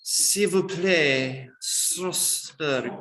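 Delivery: slow hammed-up French accent, "Strasbourg" clipped the French way; mock French accent. Teacher jokes to students in a mock French accent. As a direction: play at being French for a laugh, slow. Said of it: "I'm not French".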